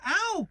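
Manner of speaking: squeaky ow. Acted. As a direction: yelp coming down. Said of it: "he said".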